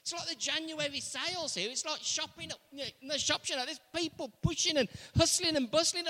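usual speaking voice

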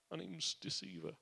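imitating Jacob's timid, squeaky admission; quiet, squeaky confession. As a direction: shrink into the name as you admit it. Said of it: "in a really squeaky voice or a quiet voice".

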